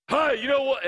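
retelling a guy who talked loud in his face; in your face. Retold or imitated. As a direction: lean right into his face. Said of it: "that's how he talked, right in my face".

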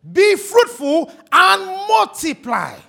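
loud, slow, high proclamation; proclaimed command. Real, not acted. Preacher to his church, a loud, slow call. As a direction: ring out God's command from the pulpit.

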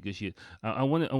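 normal talking voice

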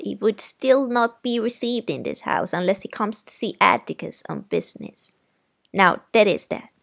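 stern aunt forbidding. Acted. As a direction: end the argument with finality.

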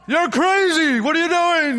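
very high, loud hollered accusation; mock demonic yelling. Preacher imitating the accusing demonic voice, high mock yelling. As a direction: holler it like a frantic heckler, words stretched. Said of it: "That's the demonic voice".

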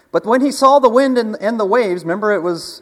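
loud, high preacher build; preaching climax. Acted. Pastor telling the wind and the waves, loud and high. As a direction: ride the storm with your voice.

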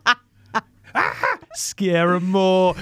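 high, slow, drawn-out mock urging; hammed-up urging. [name] egging her friend on in the ride, high and slow. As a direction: egg them on mischievously.

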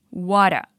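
"what a" run together as wo-ra with a flapped t; linked what a. Shown as a demonstration. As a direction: link "what a" into one word, wora. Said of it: "bem comum pronunciar assim".

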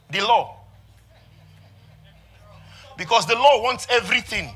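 loud, high, shouted sermon delivery; full-force preaching. Acted. Preacher to his congregation, reading the Transfiguration, shouting it.

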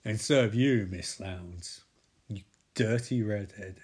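sleazy villain. Retold or imitated. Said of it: "he's like".

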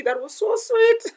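high, slow swooning over something sweet; gushing. Real, not acted. Telling Aki about the flowers, swooning high. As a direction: melt over the memory.

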